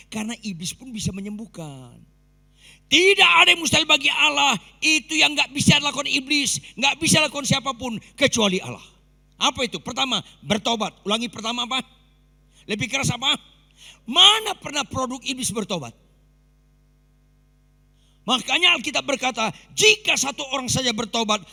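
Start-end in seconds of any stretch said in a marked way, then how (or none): 2.9-4.6 Tidak ada yang mustahil bagi Allah shouted much higher and louder; preacher roaring the verse to the congregation; proclaimed shout. Acted. Same phrase, now proclaimed. 4.8-21.4 preaching at full force. Acted. Preacher exhorting the crowd.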